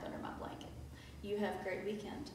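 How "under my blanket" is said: hushed, very soft whisper; cosy whisper. Real, not acted. Teacher confiding nap plans to pupils, cosy whisper.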